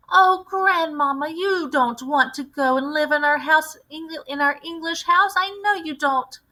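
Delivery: put-on high, young voice protesting to grandmother; a boy begging not to move; young grandson pleading with his grandmother. A narrator's reading.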